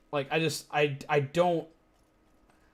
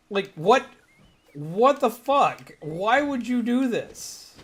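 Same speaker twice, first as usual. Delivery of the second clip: slowed, higher, stretched-out disbelief; 5 semitones higher, slower than usual. Real, not acted. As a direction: drag each word in outrage. Outraged at parent.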